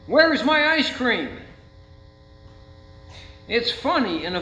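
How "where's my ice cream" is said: put-on high, loud whining kid; whiny child. Pastor imitating a child demanding ice cream, high and loud whine. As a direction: whine it like a cheated four-year-old. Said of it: "he's crying".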